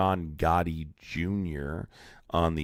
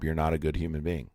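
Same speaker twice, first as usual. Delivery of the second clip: usual speaking voice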